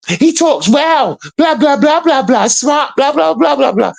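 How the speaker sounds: high, slow sing-song mock of a proper speaker; mocking educated voice. Mocking a YouTuber's educated talk, high and slow. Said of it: "he sounds educated".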